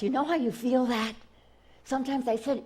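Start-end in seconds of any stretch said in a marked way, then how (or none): none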